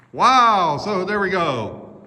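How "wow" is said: loud wow into the tunnel; echo shout. Real, not acted. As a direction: call out to test the echo.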